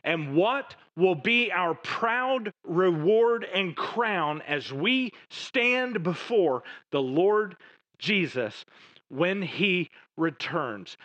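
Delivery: normal talking voice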